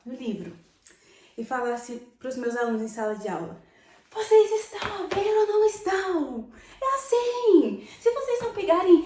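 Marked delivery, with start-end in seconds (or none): 4.1-9.0 high, loud scolding of students; harsh teacher scolding. Retold or imitated. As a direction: bark at the class like an abusive teacher. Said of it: "Se eu falar assim, no Brasil, é um abuso". (professora ralhando alto e agressiva)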